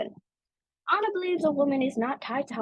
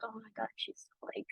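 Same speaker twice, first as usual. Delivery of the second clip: much softer, dismissive mock grumble; hushed eye-rolling coworkers brushing off complaints; 3 semitones higher, 8 dB quieter than usual. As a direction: brush her off like an annoyed coworker. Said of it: "But they're like".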